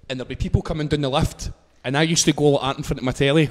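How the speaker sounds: normal talking voice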